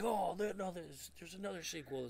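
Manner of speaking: high, soft mock exclamation; mock reaction. Mocking future reaction to more sequels.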